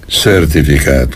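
usual speaking voice

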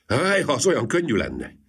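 long wistful sigh into words; mild hopeless longing. A narrator's reading. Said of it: "sóhajtott fel Gyuri".

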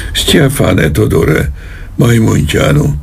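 high, drawn-out call without extra volume; bellowed call. Acted. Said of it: "Üvöltött". (visszafogottan üvöltött segélyhívás)

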